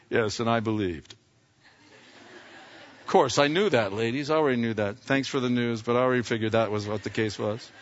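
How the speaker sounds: low, offhand know-it-all; smug apostle. Acted. Apostle writing about himself to the ladies, smug. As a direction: play it cool, as if never in doubt. Imagined apostle boasting he believed.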